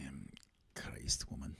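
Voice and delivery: hushed Scottish grumble; mock-exasperated Scot, very soft; Scottish accent. Host answers co-host's request for the accent, in hushed Scottish. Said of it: "Maybe stick with your Scottish accent".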